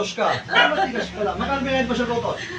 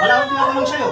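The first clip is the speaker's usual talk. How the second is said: high, loud scolding; angry rebuff; 10 semitones higher, 8 dB louder, much faster than usual. Real, not acted. Healer silences an unwanted answer, angry rebuff. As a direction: cut them off sharply at full voice.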